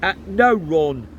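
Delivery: dry sarcastic reply; mock him with sarcasm. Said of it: "very sarcastic".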